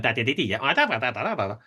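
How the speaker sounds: quick-fire patter. Shown as a demonstration. Describing music hall comedy, mimicking rapid patter. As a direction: rattle off rapid da da da. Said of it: "quick fire kind of".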